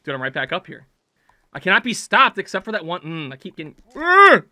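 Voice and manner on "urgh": loud, high exasperated grunt; frustrated growl. Real, not acted. Player slips yet another time, frustrated growl. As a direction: growl out the annoyance. Failed climb in game.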